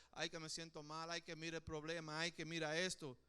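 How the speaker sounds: low, soft self-pitying whine; mock whining. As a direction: whimper it like someone feeling sorry for themselves. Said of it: "no quiero que tú te empieces a meter en la cabeza". (quejido burlón, más grave y suave)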